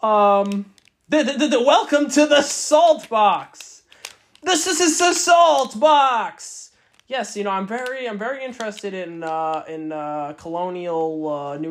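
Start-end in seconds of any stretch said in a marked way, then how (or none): 1.1-6.7 loud, slow, giddy announcing; hyped-up and loud, giggling; overstimulated. Real, not acted. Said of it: "I'm, like, overstimulated right now".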